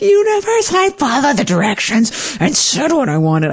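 high, pleading voice aimed at the sky; high mock plea to the universe. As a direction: beg the universe in a high, wounded tone.

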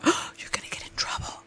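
put-on scared whisper of a little girl; inner little girl. Inner child whispering warning to herself. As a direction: whisper it like a guilty seven-year-old. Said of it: "a little girl going".

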